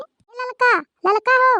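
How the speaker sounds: high, loud call across the yard; calling out to Lalka from outside, loud and high. Acted. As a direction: hail the neighbour from outside.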